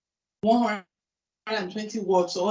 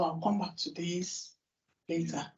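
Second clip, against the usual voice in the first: dismissive; 3 semitones lower, 8 dB quieter than usual. Retold or imitated. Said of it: "You will tell yourself".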